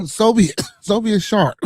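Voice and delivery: loud, high call of the name; shout-out. Real, not acted. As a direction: call him out loud across the room. Shouting out a chat member.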